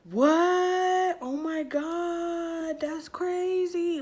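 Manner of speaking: gasp, then loud drawn-out high exclamation; hammed-up astonishment, stretching every word; mock shock. Friend hearing she might be pregnant, hammed-up shock.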